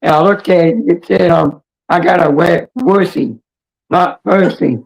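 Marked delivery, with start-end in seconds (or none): none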